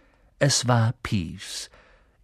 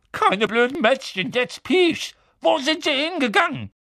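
much higher, urgent demand; Filch, exasperated. A narrator's reading. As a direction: lose patience as a harried caretaker. Filch impatiently interrogates Peeves.

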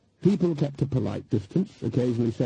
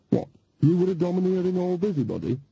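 higher, mock-innocent needle; innocently; 6 semitones higher than usual. Nanny teasing Granny at the tavern table, mock innocent. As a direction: feign innocence while teasing. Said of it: "said Nanny innocently".

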